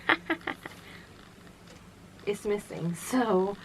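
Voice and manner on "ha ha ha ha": high, teasing mock laugh; pitched-up staccato giggle; imitated child's giggle. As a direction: mimic a little girl caught sneaking. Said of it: "and she'd".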